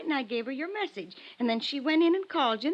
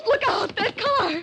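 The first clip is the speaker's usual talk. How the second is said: high, loud panicked warning; terrified scream; 8 semitones higher, 8 dB louder, slower than usual. Acted. Woman warns detective of the car, screaming.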